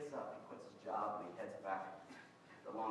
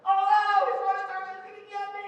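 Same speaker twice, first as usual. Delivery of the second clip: panicked high yell, much louder; freaking out; 17 dB louder than usual. Retold or imitated. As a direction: shriek it like he's about to be caught. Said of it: "imagine the boy freaking out".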